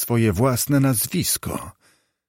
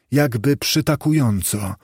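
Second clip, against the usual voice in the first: usual speaking voice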